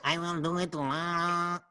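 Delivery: put-on slow, drawn-out Mexican accent; mock lazy Mexican accent, stretched slowly. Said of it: "Slowpoke Rodriguez".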